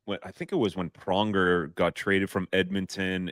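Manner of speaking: usual speaking voice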